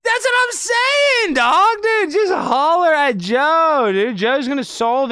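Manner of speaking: very high, loud, stretched-out hollering; over-the-top squealing cheer; hammed-up hype shout. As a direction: yell like a wildly hyped fan, easing down. Over-the-top cheering after replayed clip.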